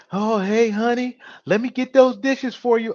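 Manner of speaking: a hint of a fawning husband offering to help; mock simp husband. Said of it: "If he's all".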